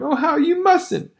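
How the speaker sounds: pleading cry. Acted. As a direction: beg her brother to stop.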